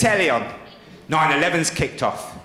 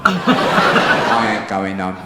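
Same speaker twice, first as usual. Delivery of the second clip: slow, low, smug muttered thought; defiant inner voice; 9 semitones lower, much slower than usual. Retold or imitated. To himself at the pub, smug and slow. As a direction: savour the decision to stay out.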